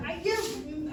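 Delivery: ordinary talk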